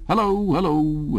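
high, slow hearty hello; bright slow cheery hello, hello; cheery greeting. A narrator's reading. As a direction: beam it out like a jolly neighbour. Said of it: "with a cheery".

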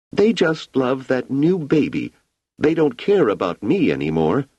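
put-on a jealous girl pouting about the new baby; sulky little girl. A narrator's reading. Wanda complains to Hugo about the new baby, sulking.

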